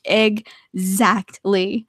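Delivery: "Exactly" very slow, broken into separate syllables; drawn-out emphatic agreement. Real, not acted. Agreeing about everything at arm's reach, "Exactly" slowly split up.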